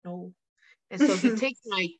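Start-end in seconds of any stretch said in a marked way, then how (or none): 0.0-0.3 very soft, barely-voiced no; quiet broken no. Fighter admitting to her coach, a near-silent no. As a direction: let the truth slip out softly. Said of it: "I'm like".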